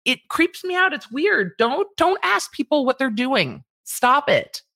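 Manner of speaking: high, disgusted exclamation; creeped out. Real, not acted. Host telling professors off, high and disgusted. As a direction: recoil in disgust. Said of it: "talker venting disgust".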